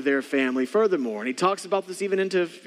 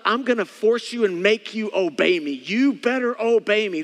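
insistent mock command, leaning on each word; forceful demand; 4 semitones higher, 4 dB louder than usual. A harsh voice unlike God's, demanding obedience. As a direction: lay down the law, slowing on the last order. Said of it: "That's not God's voice".

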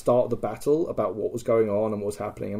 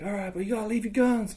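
high, softer mock call; imitated guard's terms; 8 semitones higher, 5 dB quieter than usual. Enemy picket sets parley terms, high imitated call. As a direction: call back conditions from afar. Enemy calls back conditions.

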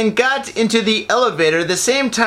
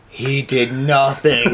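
hypnotised cop; 6 semitones lower, slower than usual. Retold or imitated. Cops hypnotised, low slow.

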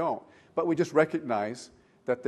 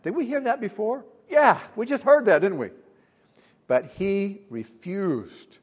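ordinary talk, nothing marked

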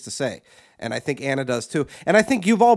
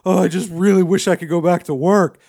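mock longing whine; 7 dB louder than usual. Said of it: "which is".

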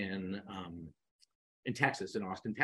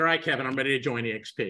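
short and to the point; 4 semitones higher, 6 dB louder, faster than usual. Retold or imitated. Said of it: "very kind of, like, short and to the point".

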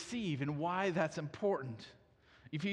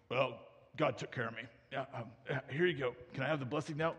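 low impostor voice, hesitant; Jacob posing as Esau; 6 semitones lower, 4 dB quieter, faster than usual. Acted. Son asking his father for the blessing, low disguised voice. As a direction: bluff in a lowered, uncertain voice.

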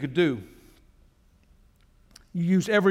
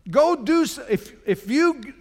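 fervent preaching; 6 semitones higher than usual. Acted. Pastor urges idle believers to go work, rising preaching. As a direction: push the listeners to get up and act.

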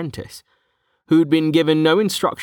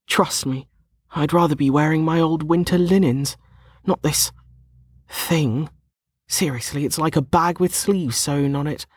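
put-on higher-pitched, exasperated young woman; complaining young woman; 7 semitones higher than usual. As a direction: grumble about the outfit in a raised character voice. Voices Lana complaining.

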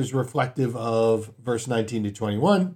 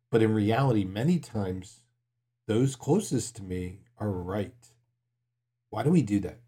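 usual speaking voice

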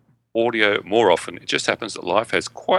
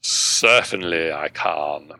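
usual speaking voice

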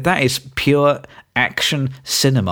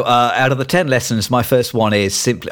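normal talking voice

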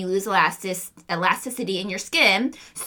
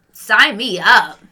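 slow, louder, emphatic; eager; 9 dB louder, much slower than usual. Real, not acted. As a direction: lean in, sell it. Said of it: "eager declaration".

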